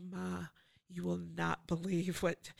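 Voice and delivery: breathless whisper to mom; excited whisper. Retold or imitated. Daughter calling her mom with big news, whispering. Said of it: "and I was like".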